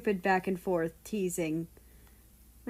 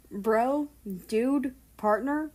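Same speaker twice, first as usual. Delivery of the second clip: mock Southern American accent, a bit higher and slow; bad Southern accent; 5 semitones higher, slower than usual. A narrator's reading. Russia teasing America back in a lightly voiced fake Southern accent. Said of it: "also putting on a shit accent".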